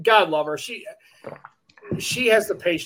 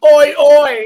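loud, high Oi oi shouted like a Brit; mock British shout; 8 semitones higher, 11 dB louder than usual. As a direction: yell it like a tourist showing off. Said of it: "he comes back and goes".

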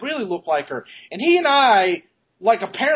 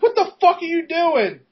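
much higher, urgent, half-mocking alarm; alarmed hiss; 12 semitones higher than usual. Friend grabs his arm and demands, high and alarmed. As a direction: yank him back with a shocked high demand.

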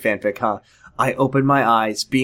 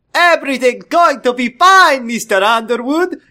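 put-on high, loud Doctor Nick accent; hammed-up Doctor Nick accent, cartoon-doctor cheer; very funny accent; 10 semitones higher, 5 dB louder than usual. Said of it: "in a very funny accent".

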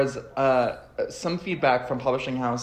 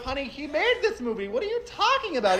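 high, disbelieving sass; hammed-up exasperated honey; mock incredulous; 12 semitones higher than usual. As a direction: talk down to the fans, incredulous. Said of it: "And I'm like".

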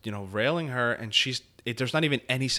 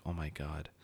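mock sadness; 7 semitones lower, 12 dB quieter than usual. Shown as a demonstration. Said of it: "emote sadness".

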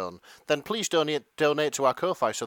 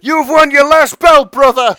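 loud, high wrestling promo shout; Hulk Hogan; 12 semitones higher, 17 dB louder than usual. Acted. Wrestler roaring at his opponent, loud and hammy. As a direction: bellow it like a wrestler cutting a promo. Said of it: "answer to Hulk Hogan".

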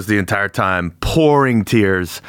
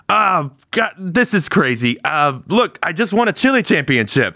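higher, rambling brag; dazed bragging; 9 semitones higher than usual. Acted. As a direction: show off the win, pitched up. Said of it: "I'll be like".

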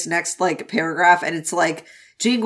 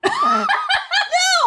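shrill burst of a laugh, much higher; high squeal laugh. Real, not acted. Cracking up at the no-sex book, high squealing laugh. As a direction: squeal with delight at the joke.

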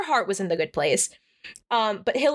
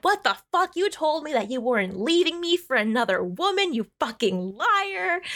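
higher-pitched mock accusation; acted outrage; 6 semitones higher than usual. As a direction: retell it as an indignant outburst, pitch jumping up. Acted confrontation with cheating husband.

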